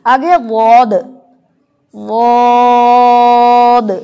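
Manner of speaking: वौद held very long and loud; heavy zwaad. Shown as a demonstration. Teacher demonstrates वौद, drawn out. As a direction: stretch वौद heavy with the tongue on the palate. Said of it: "ज़ुबान ... ऊपर तालू की तरफ उठ रही".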